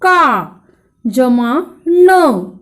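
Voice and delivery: जमा न said very slowly, each part set apart; slow spelling out. Shown as a demonstration. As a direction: draw the parts out slowly. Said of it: "उच्चार हो जाएगा".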